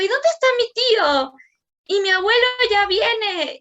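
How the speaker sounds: put-on excited child's question, pitched high; eager child voice. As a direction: voice her son eagerly asking for his uncle. Voicing her son asking eagerly.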